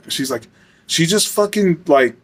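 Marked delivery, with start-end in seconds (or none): none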